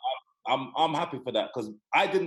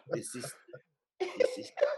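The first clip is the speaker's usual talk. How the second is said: collapse into laughter mid-phrase; mock dad refrain; 5 dB quieter than usual.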